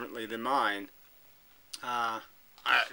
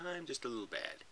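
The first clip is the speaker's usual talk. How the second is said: little bad; 12 dB quieter, faster than usual. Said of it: "or it says".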